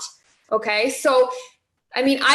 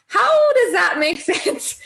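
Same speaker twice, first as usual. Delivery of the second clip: laughing disbelief; 5 semitones higher, slower than usual. Real, not acted. Laughs at the absurd excuse.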